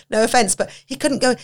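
normal talking voice

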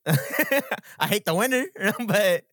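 chuckling through the words; laughing. Real, not acted. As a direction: laugh while saying it. Laughing through own joke.

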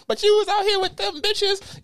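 high, loud mock yell; mock yelling girlfriend. Girlfriend turning the argument around, high mock yell. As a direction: screech the accusation way up high. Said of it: "she gonna start yelling".

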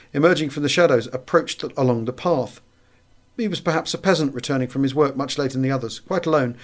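ordinary delivery

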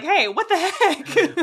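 loud, high, drawn-out complaint; hammed-up indignation; mock protest. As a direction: protest playfully, breaking into laughter. Acts out own mock protest.

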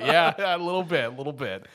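mock agree through laughter; laughing little bit fading off; laughing sarcastic reply. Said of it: "It's like".

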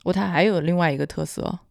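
ordinary talk

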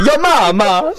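put-on high Italian accent yell. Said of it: "Italian accent".

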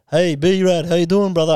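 put-on loud Aussie wog accent greeting; cousin's wog accent. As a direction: boom it out like one of the boys. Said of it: "he'd be like".

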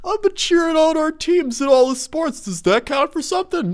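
high, pleading whine; mock whiny sorority girl. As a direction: whimper it like a sulky kid. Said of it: "in a whiny little bitch voice".

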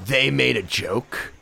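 low unhurried deadpan; deadpan quote. Retold or imitated. Quoting a man's deadpan joke.

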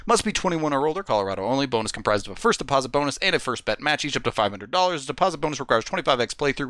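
rapid-fire legal fine print; fast legal disclaimer. Acted. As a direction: rattle off the terms in one breath. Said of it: "Almost did it in one breath that time".

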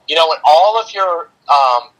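ordinary talk, nothing marked

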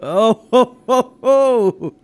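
teasing. Acted. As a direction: rib him about his night out. Teasing about evening at Chelsea's.

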